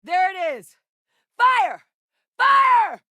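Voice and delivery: high, urgent shouted command; alarmed battle yell, pitch soaring; combat shout. Acted.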